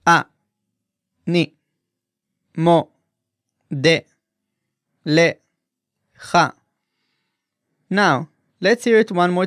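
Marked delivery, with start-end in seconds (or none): 0.1-6.5 אני מודה לך very slow, each syllable apart; split into syllables, slow. Shown as a demonstration. Teacher breaking the male phrase into syllables. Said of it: "break it down by syllable".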